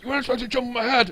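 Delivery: fast, high angry quarrel; angry argument. Retold or imitated. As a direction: snap back in a quarrel. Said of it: "it's like".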